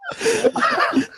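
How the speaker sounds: high short cackle; real giggle fit; laughing. Real, not acted. Laughs at the silly title.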